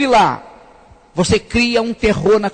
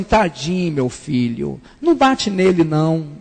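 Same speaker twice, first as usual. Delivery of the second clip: softer, lower pleading mother; a hint of a gentle mother begging; mother's plea; 6 semitones lower, 3 dB quieter than usual. Mother pleads with her son to spare the grandson, softly. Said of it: "minha mãe falou assim".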